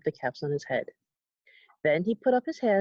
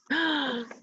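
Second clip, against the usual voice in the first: sharp surprised intake of breath; a storyteller's hammed-up gasp; 6 semitones higher than usual. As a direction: gasp at the discovery. Discovers the caps are gone.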